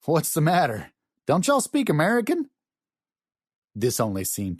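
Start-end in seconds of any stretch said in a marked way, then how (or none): 0.1-2.5 high, challenging Southern twang; rich Southern accent. A narrator's reading. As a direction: needle them in a broad Southern accent. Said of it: "said in a rich Southern accent".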